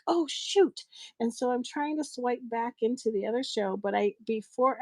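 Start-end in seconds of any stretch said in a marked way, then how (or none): 0.1-0.8 high mock exclamation; retold dismay. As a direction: pitch it up as if just caught out. Reaction to swiping into wrong show.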